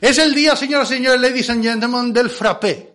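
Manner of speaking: high, loud showman's call; ringmaster announcement. Pretend. Host announcing frappé day to ladies and gentlemen, ringmaster announcement. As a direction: proclaim it to the whole crowd.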